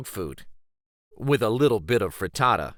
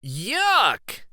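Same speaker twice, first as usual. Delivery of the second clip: disgusted yuck; 8 semitones higher than usual. Acted. Friend sees fish in backpack.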